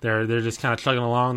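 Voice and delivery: usual speaking voice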